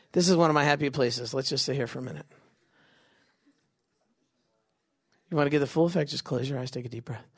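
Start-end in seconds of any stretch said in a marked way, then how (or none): 1.3-7.3 low, soft relaxation voice; mock meditation. Game leader luring players into closing eyes, softly meditative. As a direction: lull them, fading almost to nothing.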